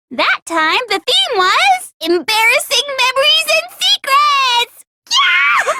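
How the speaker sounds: high, squealing cheerleader voice; bubbly cutesy glee. Acted. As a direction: cheer them on like a giddy quiz host. Cheery quiz host voice.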